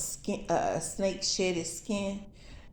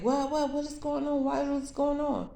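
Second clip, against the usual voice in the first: alarmed; 7 semitones higher than usual. Retold or imitated. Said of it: "they was like".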